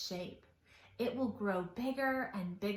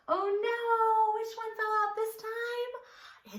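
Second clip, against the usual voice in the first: high-pitched fast oh no; mock alarm; 11 semitones higher than usual. Storyteller to children, mock surprise. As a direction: play up the shock for the kids.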